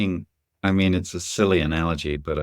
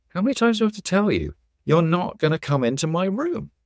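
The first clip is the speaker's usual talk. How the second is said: imitating a playful kid, much higher voice; smiling mock scolding; 10 semitones higher than usual. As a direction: tease with a high-pitched, smiling refusal. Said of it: "kid starts to smile and says".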